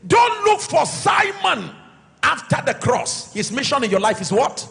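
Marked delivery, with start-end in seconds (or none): 0.1-3.3 high, slow preacher's command; forceful exhortation. Real, not acted. Pastor warning church not to seek past helpers, forceful exhortation. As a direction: ring it out as a warning from the pulpit.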